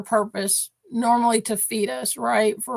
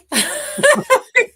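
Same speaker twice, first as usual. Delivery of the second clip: loud high laugh; 13 semitones higher, 6 dB louder than usual. Real, not acted. Owner laughing at the dog she just voiced, loud high laugh.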